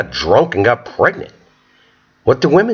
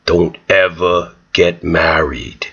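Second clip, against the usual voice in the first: weary fatherly advice; 7 semitones lower than usual. Retold or imitated. Dad advising his son at the barbecue, low and grave. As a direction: lay it down like a tired father. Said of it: "the guy's like".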